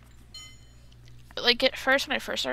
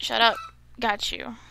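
ordinary talk, nothing marked

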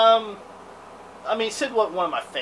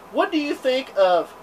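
ordinary delivery

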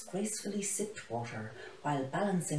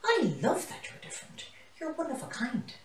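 delighted lioness; 10 semitones higher than usual. A narrator's reading. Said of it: "let out a delighted roar".

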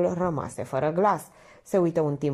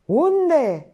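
high, loud bellowed question; roar; 11 semitones higher, 8 dB louder than usual. A narrator's reading. Painter losing patience with Minerva, roaring. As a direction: explode with impatience. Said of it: "răcni pictorul".

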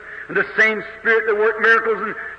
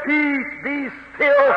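slow, measured command; calm command; 5 semitones higher, slower than usual. Acted. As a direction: still the storm, word by word. Jesus calming wind and waves.

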